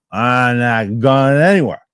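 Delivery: put-on slow, loud, drunken stubborn voice; drunk refusal. Drunk college comic refusing his frat friends, slow and sloppy. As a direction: drag it out like you're too drunk to stand. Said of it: "And I go".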